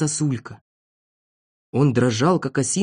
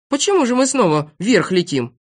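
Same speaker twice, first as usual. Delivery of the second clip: higher, puzzled question; surprised; 7 semitones higher than usual. A narrator's reading. Passenger wonders why balloon rises, surprised. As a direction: ask in mild astonishment. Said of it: "удивился Авоська".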